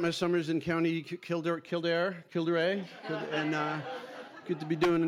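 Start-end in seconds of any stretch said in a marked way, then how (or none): none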